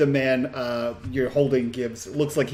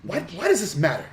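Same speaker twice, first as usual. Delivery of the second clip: put-on impatient child, pitched up; ostentatious young girl; 5 semitones higher than usual. Girl challenging her rescuer's question, higher and impatient. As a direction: question them like a haughty little girl. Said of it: "she looks to you and says".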